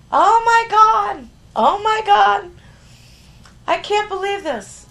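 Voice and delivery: high, loud, slow shriek of disbelief; shrieking woman in a restaurant; retold scream. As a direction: scream it out like a stunned stranger. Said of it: "She started screaming in this place".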